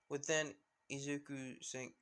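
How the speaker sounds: usual speaking voice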